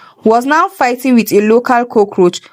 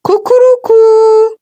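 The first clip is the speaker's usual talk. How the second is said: much higher, loud crowing rooster call; 12 semitones higher, 5 dB louder than usual. A narrator's reading. Landlady's scream comes out as a loud rooster crow. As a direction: crow like a cock. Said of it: "what came out was".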